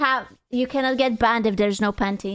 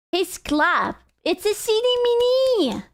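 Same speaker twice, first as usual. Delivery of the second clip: cutesy voice; 8 semitones higher than usual. Real, not acted. Cooing over cute emote.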